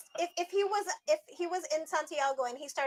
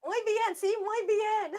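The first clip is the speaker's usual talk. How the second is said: playful Spanish; 8 semitones higher, slower than usual. Acted. Mimicking the teacher's Spanish.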